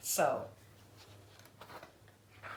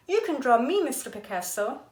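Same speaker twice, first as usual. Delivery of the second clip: eager; 8 semitones higher, 8 dB louder than usual. Acted. Girl eagerly volunteering herself.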